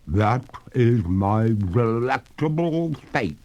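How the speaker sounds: a dripping ghost lady, amused and unhurried; water ghost. A narrator's reading. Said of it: "returned the lady".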